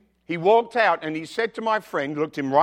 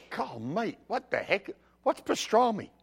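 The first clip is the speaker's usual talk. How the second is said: baffled newcomer; 5 semitones higher, 7 dB quieter than usual. Retold or imitated. Retold bewildered newcomer reading menu.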